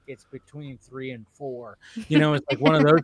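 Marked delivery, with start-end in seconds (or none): 0.1-1.8 slow, much softer aside; whispering the answer to dad in the theater. Retold or imitated. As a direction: lean in and barely say it. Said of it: "I just kinda whispered".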